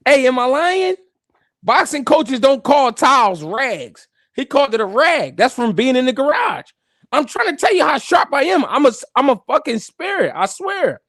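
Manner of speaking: usual speaking voice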